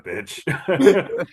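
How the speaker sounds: bursts out laughing on the word; a loud laugh breaks through the insult. Real, not acted. Host laughing at his cohost's bazinga joke, laughing.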